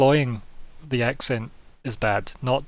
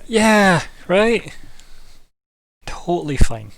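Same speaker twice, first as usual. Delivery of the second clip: loud, high sarcastic agreement; 6 semitones higher, 7 dB louder, slower than usual. Real, not acted. Sarcastic reply to sorcerer remark.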